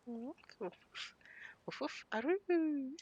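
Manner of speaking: slow playful barking ending in a drawn-out aroo howl; mock puppy barks and howl. Playing along with the puppy in the game, slow mock barks and a howl.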